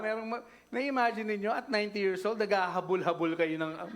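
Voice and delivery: ordinary talk, nothing marked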